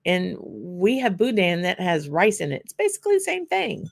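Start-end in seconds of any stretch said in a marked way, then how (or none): 2.6-3.9 emphatic insistence. Real, not acted. Insisting haggis equals boudin.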